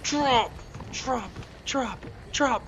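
desperate high shouts of drop; frantic yelling. Real, not acted. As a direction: yell the command again and again in panic. Panicked yelling at game character.

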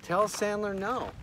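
slow, high-pitched insistent order; acted angry demand. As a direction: play a petulant demand, word by word. Assistant demands refusal in retold quarrel.

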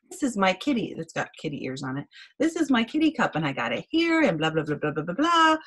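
lightly acted little kid presenting; kindergartner at show and tell. Kindergartner showing a kitty cup to class, childlike recital. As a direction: recite it like a kindergartner, lilting up at the end. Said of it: "Remember in kindergarten when you had show and tell?".

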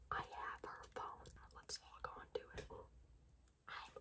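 sneaky whisper. Real, not acted. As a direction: whisper so she can't hear. Sneaking on sister's phone.

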